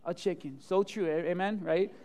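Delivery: ordinary delivery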